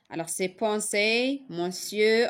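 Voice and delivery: usual speaking voice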